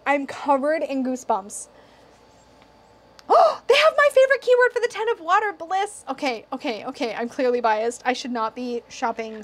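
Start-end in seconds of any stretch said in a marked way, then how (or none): 3.3-6.0 much higher and louder squeal of delight; a gleeful rush of joy at finding it; thrilled. Real, not acted. Finds favorite keyword.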